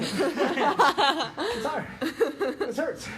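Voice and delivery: mock a tired friend's complaint; Nate impression. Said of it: "that's my Nate impression".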